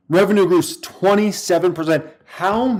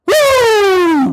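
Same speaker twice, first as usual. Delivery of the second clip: very high, loud drawn-out woo; celebratory whoop; 6 dB louder than usual. Real, not acted. Streamer cheering Palantir's results, ecstatic whoop. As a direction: whoop like your stock just soared.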